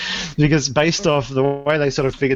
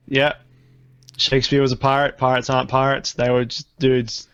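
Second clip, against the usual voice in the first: ordinary talk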